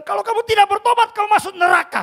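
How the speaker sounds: loud, high shouted warning; retold angry warning. Pastor shouting at a phone scammer to repent, loud and high. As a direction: yell the threat at the scammer. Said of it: "Saya bilang".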